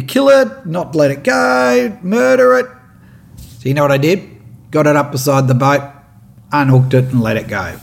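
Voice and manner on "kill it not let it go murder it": higher, slow, drawn-out heckling shout; mock bloodthirsty yell. Jeering boaters urging the kill, slow mock yell. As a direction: egg on the kill like a jeering crowd. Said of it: "these muppets are yelling out at you".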